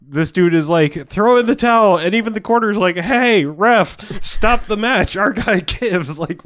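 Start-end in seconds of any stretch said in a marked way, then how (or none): 1.1-6.2 higher, louder pleading call to the ref; mock yelling. The corner calling out to the ref, mock shouted and high. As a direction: shout for the match to stop, hammed up.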